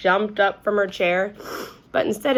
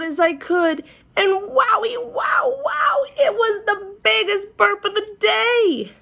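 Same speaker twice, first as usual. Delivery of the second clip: excited; 13 semitones higher, 3 dB louder than usual. A narrator's reading. Squeezing the burp toy loud.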